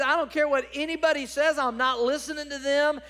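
loud, high, insistent preaching shout; defiant outburst. Acted. Preacher as David dismissing his enemies' talk, loud defiance. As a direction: throw it back at the doubters at full force.

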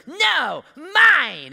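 high, loud bratty "No, mine"; greedy toddler. Retold or imitated. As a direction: squeal it like a greedy little kid. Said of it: "the kid goes".

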